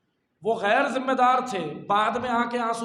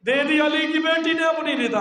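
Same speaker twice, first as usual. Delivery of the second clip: high, loud wailing proclamation; impassioned lament; 8 semitones higher, 7 dB louder than usual. Acted. Preacher telling mourners of the daughter's sacrifice, impassioned lament.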